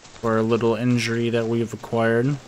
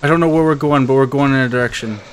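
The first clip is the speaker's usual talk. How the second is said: excited rush; 3 semitones higher, 7 dB louder, faster than usual. Real, not acted. Fleeing after the escape, loud fast excitement. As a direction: race on breathless with glee.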